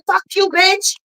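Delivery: quoted insult. Retold or imitated. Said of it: "she said".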